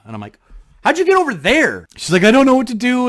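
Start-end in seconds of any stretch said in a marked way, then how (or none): 0.8-1.9 high, loud disbelieving question; can't believe where she ended up; baffled. Retold or imitated. To his lost friend, high loud disbelief. 2.2-3.1 high and loud, mock panic; imitating a friend who's lost and scared; panicky. Lost friend on the phone, high loud panic.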